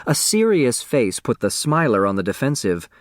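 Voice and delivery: usual speaking voice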